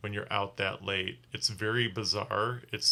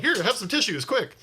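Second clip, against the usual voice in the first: much higher, louder hurried urging; urgent offer; 11 semitones higher, 5 dB louder than usual. Retold or imitated. Driver urging the sick passenger to take tissues, hurried. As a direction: push the tissues at him in a rush. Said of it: "I was like".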